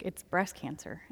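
very soft, low, understated delivery; near-tearful doctor. Retold or imitated. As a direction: quietly retell the doctor's grave news. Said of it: "she's almost crying".

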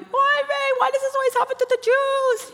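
very high, wailing put-on old lady voice; upset lady on the diverted plane, very high wail. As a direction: wail it like a frantic passenger. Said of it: "running around the airplane going".

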